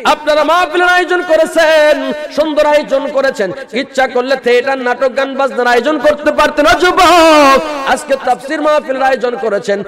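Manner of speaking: high, loud, chanted appeal; full-force preaching. Acted. Preacher pleading with the young men, high and loud. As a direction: cry out to the young men in a sung pitch.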